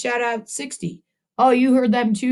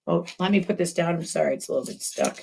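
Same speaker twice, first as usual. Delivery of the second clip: usual speaking voice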